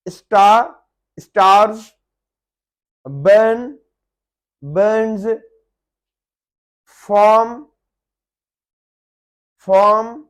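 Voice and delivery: loud model words like बर्न and फॉर्म, R dropped; silent R, British. Shown as a demonstration. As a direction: say स्टार, बर्न, फॉर्म, वर्ल्ड loudly without the R. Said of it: "vowels के बाद आर pronounce नहीं करनी". (स्टार, बर्न, फॉर्म, वर्ल्ड ज़ोर से, आर के बिना)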